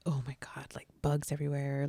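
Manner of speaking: griping whisper. Retold or imitated. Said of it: "he's like".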